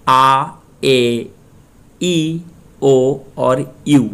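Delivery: आ, ए, ई, ओ said slowly, each separately; Spanish vowel sounds. Shown as a demonstration. Teacher shows Spanish pronunciation, sounding आ, ए, ई, ओ separately. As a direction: space out आ, ए, ई, ओ as long vowels. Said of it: "Spanish में pronunciation कैसे करते हैं".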